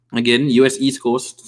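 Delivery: normal talking voice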